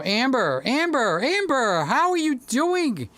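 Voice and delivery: rising sing-song call of a name. Real, not acted. Calling out to Amber.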